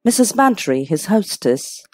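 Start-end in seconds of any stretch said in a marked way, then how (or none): none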